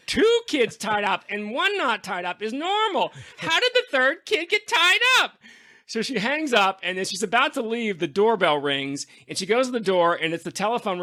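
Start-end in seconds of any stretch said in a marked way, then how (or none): 0.1-5.3 high, far-off mock character voice; tossing off the flustered character's line, pitched way up; playing the babysitter's puzzled line; quoting a character. Retelling a comic character's line.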